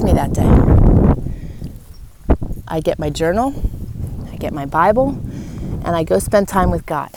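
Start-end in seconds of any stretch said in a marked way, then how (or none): none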